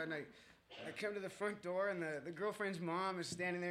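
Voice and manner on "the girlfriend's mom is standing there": ordinary talk, nothing marked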